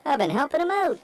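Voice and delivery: christmas elf. Pretend. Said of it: "Disguise your voice".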